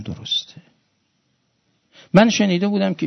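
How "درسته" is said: barely voiced, breaking with tears; faint tearful whisper. Dying scholar confirms the story while weeping, faint whisper. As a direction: whisper it through weeping, almost no voice. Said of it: "شون گریه کردن".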